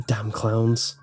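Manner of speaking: ordinary delivery, nothing marked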